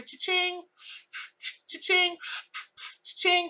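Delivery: high bright imitated register ring, repeated; cash register cha-ching. Imitating a cash register while a client smokes, high and ringing. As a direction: ring it out like a till in her head. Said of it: "all I could hear in my head".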